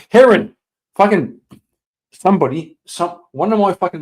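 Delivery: a sudden high, loud yelp of a name; outraged yell. Real, not acted. Streamer reacting to a rude chat joke, shocked high yell.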